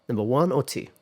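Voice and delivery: usual speaking voice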